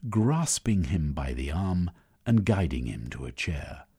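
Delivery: ordinary talk, nothing marked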